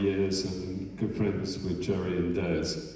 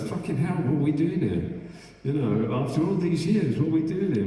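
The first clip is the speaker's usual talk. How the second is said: incredulous aside; 6 semitones higher than usual. Retold or imitated. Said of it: "I look at Charlie sometimes and I say".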